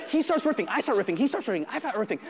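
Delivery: ordinary talk, nothing marked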